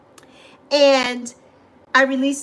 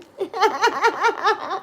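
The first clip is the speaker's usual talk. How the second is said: short, higher, choppy laugh; light laugh; 6 semitones higher than usual. Real, not acted. Host giggling to viewers about Yappy.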